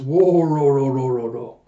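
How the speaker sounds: big rolling laugh starting loud, then falling away; imitated villain laugh. Villain mocks the lord in the pot, imitated rolling laugh. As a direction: boom the first syllable and roll it down.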